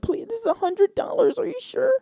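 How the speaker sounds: very high, squeaky stunned voice; squeaky disbelief. Retold or imitated. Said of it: "And the lady's like".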